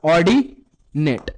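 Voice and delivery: low, drawn-out ordinate for pupils to echo; slow modelled answer. Shown as a demonstration. Teacher models the new term to pupils, slow and drawn out. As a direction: stretch ordinate out slowly, syllable by syllable.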